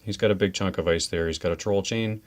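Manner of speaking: usual speaking voice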